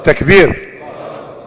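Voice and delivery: rallying call. Real, not acted. Leader calls the congregation to praise, rallying call. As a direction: call out for the crowd's response.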